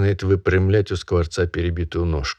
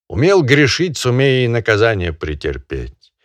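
pompous barin; 2 semitones higher, 4 dB louder than usual. Acted. Barin sentencing the peasant, loud and pompous. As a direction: pronounce judgement grandly.

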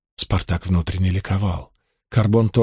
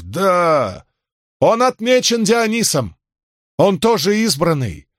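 crowd roar; 8 semitones higher, slower than usual. Acted. Crowd roars answer.

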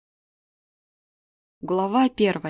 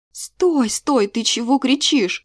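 raised, higher voice cutting in; urgent interruption; 5 semitones higher, 5 dB louder, slower than usual. A narrator's reading. Kira interrupting her yelling friend, startled and urgent. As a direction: break in, startled, to stop the tirade. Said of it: "скорее перебила я её".

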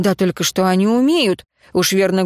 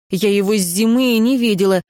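plain reading voice

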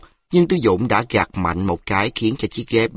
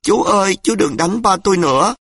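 sobbing plea; 9 semitones higher than usual. A narrator's reading. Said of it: "Nhỏ Hạnh cũng nức nở".

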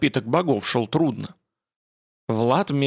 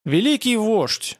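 grand address; 8 semitones higher, slower than usual. Acted. Polishchuk greets the barbarian grandly.

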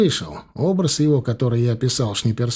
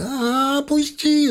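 much higher, yelping plea; squeezed thug yelps high to let go; pained cry; 13 semitones higher, much slower than usual. A narrator's reading. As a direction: cry out as his fingers are crushed. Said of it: "закричал он".

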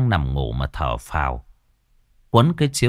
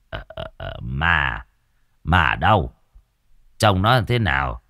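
low, hesitant stammer; spooked caretaker; 7 semitones lower than usual. A narrator's reading. Caretaker hearing of ghosts at the temple, low spooked stammer. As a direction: start with faint halting sounds, then ask warily. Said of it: "ông dựng cả tóc gáy".

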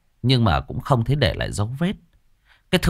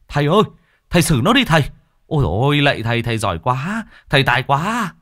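excited cheering; 5 semitones higher, faster than usual. Acted. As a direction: egg the master on like a thrilled onlooker. Villagers cheer the exorcist.

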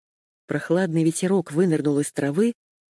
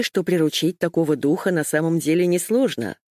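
ordinary delivery, nothing marked

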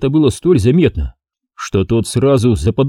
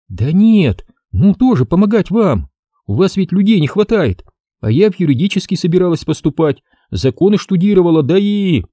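put-on girl's voice pitched higher, eager; lightly voiced young girl pleading to help; 8 semitones higher than usual. A narrator's reading. Ksenia asks Nikitin to let her help, higher and eager.

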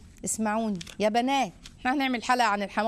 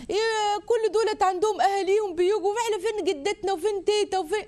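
mimicking a homesick expat; 8 semitones higher than usual. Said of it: "يقول لك".

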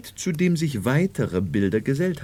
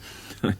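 soft breathy chuckle; 6 semitones lower, 5 dB quieter than usual. Acted. Doctor laughs at the Marchioness.

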